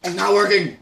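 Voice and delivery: loud pained complaint; pained shout. Real, not acted. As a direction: shout through the burning. Mouth on fire.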